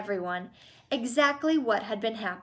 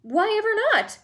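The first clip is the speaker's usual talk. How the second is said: high and louder, baffled; astonished; 11 semitones higher, 5 dB louder than usual. A narrator's reading. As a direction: pop up in disbelief. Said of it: "Wonka surprised".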